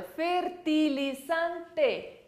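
"Fertilizante" muito devagar, com "ti" claro e E final; very slow model word. Shown as a demonstration. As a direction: estique "Fertilizante" e marque o E final. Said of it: "con E al final". ("Fertilizante" bem lento, "ti" e E final nítidos)

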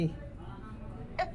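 slow, soft, tearful pleading; fake crying. Fake aunt pleading with nephew for the screenshot, slow tearful sobbing. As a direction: sob the words out slowly to win pity.

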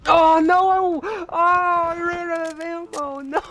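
very high, loud, drawn-out wail; panicked scream. Real, not acted. Gamer out of ammo mid-fight, panicked wailing scream. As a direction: scream it out in real dismay.

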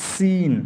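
সিন sung out high with a hissing s; crisp teacher demo of সিন; demonstrating sin. Shown as a demonstration. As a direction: model the letter সিন clearly for students. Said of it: "সিনটাকে এইভাবে উচ্চারণ করতে হবে".

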